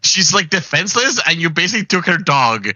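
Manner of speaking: mock outrage. Real, not acted. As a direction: GM scolding the player for taking grandma's dog. GM scolds player, mock outrage.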